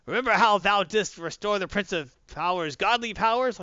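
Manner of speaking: loud, high, slow hero declaiming; grandiose Hercules. Retold or imitated. Hercules booming a grand greeting at the scientist. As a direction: boom it out like a pompous demigod.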